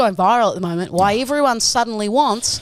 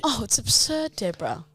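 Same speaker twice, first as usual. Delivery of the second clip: mock rich lady; 7 dB quieter than usual. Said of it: "words that really rich people".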